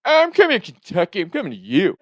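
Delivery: high, loud, rising threat; hammed-up excited prank voice; cartoon prank voice. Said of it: "Jimmy character from South Park voice".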